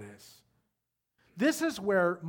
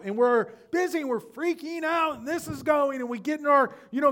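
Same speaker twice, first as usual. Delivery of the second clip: ordinary delivery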